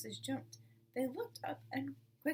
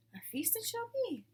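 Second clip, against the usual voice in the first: much higher, delighted announcement; joyful mother fox; 11 semitones higher than usual. Acted. Revived mother fox to her child, joyful. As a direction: sing it out with new strength.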